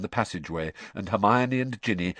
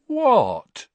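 innocent; 7 dB louder than usual. A narrator's reading. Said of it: "in an innocent tone".

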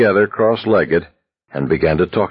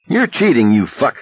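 shouting; 6 semitones higher than usual. A narrator's reading. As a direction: yell it across the table. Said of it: "Abraham shouted".

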